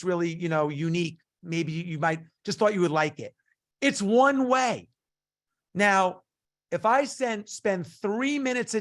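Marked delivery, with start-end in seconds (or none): 3.8-4.9 "one way" said slowly and loud with "one" hit hard; slow down and hammer "one"; stress on one. Real, not acted. Speaker to sales listeners, hammering "one way" slowly. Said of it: "listen to me here".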